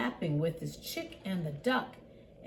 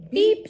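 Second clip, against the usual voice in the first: high, loud honk from a little truck; bright pop of a horn, voiced high; little truck beep; 13 semitones higher, 12 dB louder than usual. A narrator's reading. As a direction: toot a short, high beep. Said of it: "said Blue".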